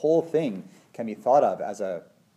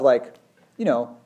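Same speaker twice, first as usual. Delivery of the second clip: ordinary talk, nothing marked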